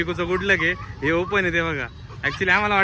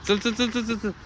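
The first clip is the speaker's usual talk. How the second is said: normal talking voice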